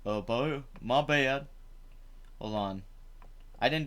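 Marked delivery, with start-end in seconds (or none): none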